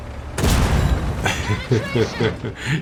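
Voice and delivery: long, soft chuckle; gloating laugh. Real, not acted. Player laughing at a doomed enemy tank, gloating laugh. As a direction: chuckle softly to yourself at length.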